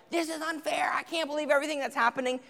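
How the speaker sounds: high, fast, mock-outraged protest; hammed-up teen blowing up, fast; mock explosion. Teen exploding at parents, mock-outraged and fast. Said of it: "after you explode".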